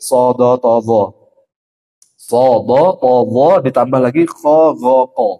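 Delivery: "ho, so, bo" full and heavy, slow; thick letter drill. Shown as a demonstration. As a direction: round but not pursed: "bo" not "bu". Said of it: "Huruf tebal tuh ini". ("bo" tebal, jangan monyong)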